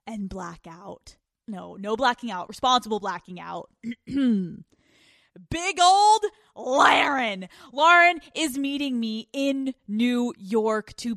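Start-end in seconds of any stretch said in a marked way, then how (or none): 4.1-11.1 hammed-up ring announcer, louder and very slow. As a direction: draw out the name like introducing a fighter. Introducing her friend Lauren.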